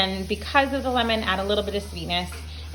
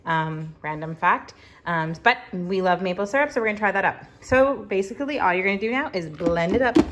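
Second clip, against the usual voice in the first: ordinary talk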